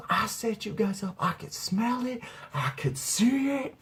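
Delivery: high, slow, stretched-out imitation; accusing grandma. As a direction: draw out each accusation as grandma.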